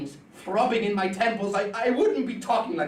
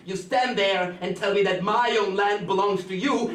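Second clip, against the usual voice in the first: normal talking voice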